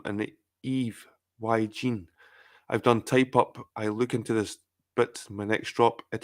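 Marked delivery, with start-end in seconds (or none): none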